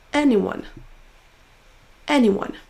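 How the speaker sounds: AN in "Anyone" strong, then falling; stressed syllable emphasized. Shown as a demonstration. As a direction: punch the first syllable of "Anyone". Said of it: "en prononçant plus fort la syllabe". (première syllabe de « Anyone » appuyée)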